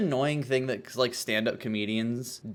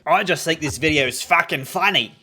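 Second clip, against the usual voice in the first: mocking quote, a bit higher and louder; mock smug YouTuber; 6 semitones higher, 7 dB louder than usual. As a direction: parodia zadowolonego youtubera, trochę wyżej i głośniej. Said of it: "does a fucking Joker laugh".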